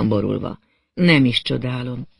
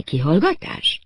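usual speaking voice

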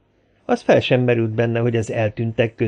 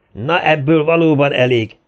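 loud, higher-pitched outburst; shouted command; 4 semitones higher, 5 dB louder than usual. A narrator's reading. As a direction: put a stop to the quarrel. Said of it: "kiáltotta Murat pisztolyt rántva". (határozottan rákiáltva)

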